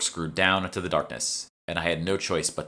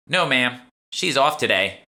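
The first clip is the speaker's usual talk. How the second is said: put-on higher, louder teen voice with a thick Boston accent; a shop girl answering in broad Boston tones; 7 semitones higher, 7 dB louder than usual. A narrator's reading. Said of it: "the girl said in a thick Boston accent".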